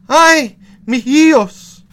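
high, loud, drawn-out cry; wailing woman. Acted. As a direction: wail slowly across the water. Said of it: "and crying out".

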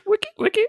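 very high-pitched DJ scratch imitation; record scratch noise. Co-host mimicking the game's scratches between levels, high record-scratch squeaks. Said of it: "use the most record scratches".

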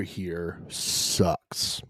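unvoiced muttered gripe; quiet, slow whisper. Real, not acted. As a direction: whisper the complaint slowly. Griping about cold weather.

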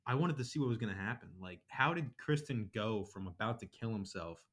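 ordinary delivery

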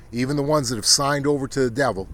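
normal talking voice

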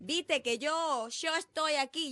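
mock Argentine accent. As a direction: drag it out like a fake porteño. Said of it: "Hay gente que tiene tres meses". (acento argentino burlón, lento y arrastrado)

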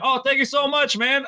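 high-pitched starstruck gratitude; giddy retold fan thanks; gushing thanks. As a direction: re-enact thanking an idol, voice way up.

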